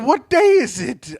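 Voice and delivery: high, hammed-up old-tale miser; Ebenezer Scrooge. Miser asks a passer-by the day, hammed-up. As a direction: call out as a theatrical storybook character. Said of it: "Do you think it was Ebenezer Scrooge".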